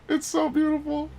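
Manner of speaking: very high-pitched adoring squeak; gushing delight. Real, not acted. Player overcome by the game's beauty, gushing.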